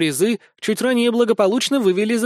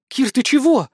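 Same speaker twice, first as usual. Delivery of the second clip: frightened; 7 semitones higher, 3 dB louder than usual. Acted. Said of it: "Ленка испуганно прикрыла рот".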